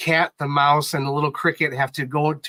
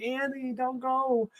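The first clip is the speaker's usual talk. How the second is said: small, high, soft cry; hushed high-pitched mock wail, drawn out; imitated forlorn plea; 9 semitones higher, 9 dB quieter, slower than usual. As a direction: plead softly as an abandoned little bear. Retells bear losing his doll friend.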